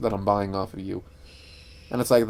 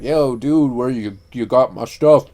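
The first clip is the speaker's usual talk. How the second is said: louder, drawn-out drug dealer voice; 6 dB louder, slower than usual. Acted. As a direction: put on a slow, loud dealer's "yo, dude". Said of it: "why did I talk like that as a drug dealer".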